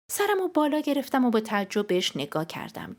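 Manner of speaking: ordinary delivery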